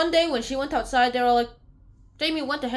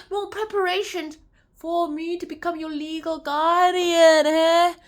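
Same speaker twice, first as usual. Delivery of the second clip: high, slow and stretched, swelling louder; emphatic correction; 6 semitones higher, 4 dB louder, slower than usual. Retold or imitated. Caretaker explains guardianship to the children, emphatic correction. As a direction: draw it out in a put-on raised voice.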